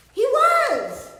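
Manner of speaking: high, loud burst of delight; excited reveal. A narrator's reading. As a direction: announce it like a big surprise.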